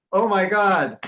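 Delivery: can't believe the contrast; amazed. Retold or imitated. Noticing the gap between America and England, amazed. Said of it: "And I'm like".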